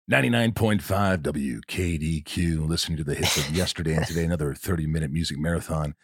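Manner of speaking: smooth radio DJ patter. Retold or imitated. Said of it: "D J voice".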